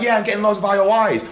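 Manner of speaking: high, mocking bragger; high mock voice of guys bragging. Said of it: "guys are always like".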